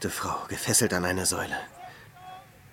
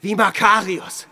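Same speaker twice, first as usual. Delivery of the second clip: proud exclamation; 10 semitones higher, 8 dB louder, slower than usual. Acted. Hermit boasting of his penance.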